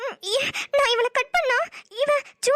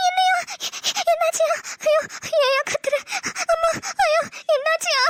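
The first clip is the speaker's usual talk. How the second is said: frightened cry; 5 semitones higher than usual. Real, not acted. Startled by his shout.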